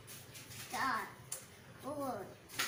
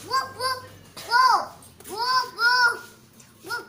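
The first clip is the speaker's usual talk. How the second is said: playful higher whooping; animal noise; 4 semitones higher, 4 dB louder than usual. Pretend. Child imitating animal sound.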